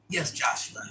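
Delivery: ordinary delivery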